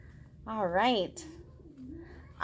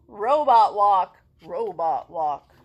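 robot walk voice; 4 semitones lower, 10 dB louder, slower than usual. Acted. Robot voice for move.

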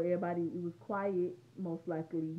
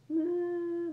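short high laugh, loud and sudden; high laugh bursting out; burst of laughter; 12 semitones higher, 8 dB louder than usual. Real, not acted. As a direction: break into a quick laugh. Laughing while describing crying.

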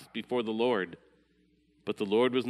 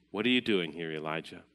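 softer and lower, fading to a hush on Elijah; gentle whisper; 6 semitones lower, 5 dB quieter than usual. Acted. God's still small voice asking Elijah in the cave, hushed. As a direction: let the question drop almost to nothing. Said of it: "came a gentle whisper".

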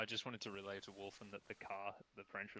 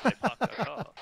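louder and higher, exasperated; exasperated repeat; 13 semitones higher, 11 dB louder than usual. Retold or imitated. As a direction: spell it out again, fed up. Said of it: "I said the French parked the car".

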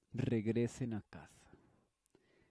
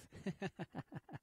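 light laugh; 8 semitones higher, 5 dB quieter than usual. Acted. Laugh after the soup reassurance.

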